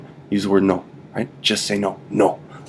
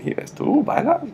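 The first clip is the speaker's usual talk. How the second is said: much higher, slow teasing ask; playful high question; slower than usual. Shown as a demonstration. Friend at the dance asking the teacher to dance, high and slow. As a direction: ask it like a flirty invite. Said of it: "You ask me the question".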